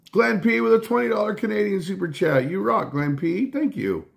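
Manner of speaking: light up for the super chat; excited shout-out. Real, not acted. Streamer hyping a viewer's donation, excited and high.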